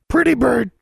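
very high, loud bird chatter; raven squawk. Acted.

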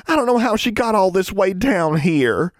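put-on high, excited girlish chatter; gushing relief. A narrator's reading. Young witch reunited with her toad, gushing.

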